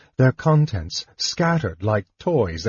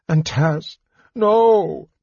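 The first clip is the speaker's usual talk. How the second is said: anguished cry; 7 semitones higher, 3 dB louder, much slower than usual. A narrator's reading. Finding wounded friend on floor.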